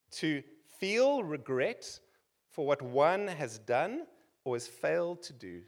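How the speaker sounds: slow, deliberate dictionary reading; reading a dictionary definition slowly. Shown as a demonstration. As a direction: read the definition slowly, word by word. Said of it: "this is what the Oxford Dictionary says".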